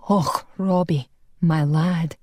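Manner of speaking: lightly voiced Scotsman, slow and tender; a touch of a Highland accent, slow; a hint of a kindly Scot over the lad; Scottish brogue. Scottish Angus comforts Robbie.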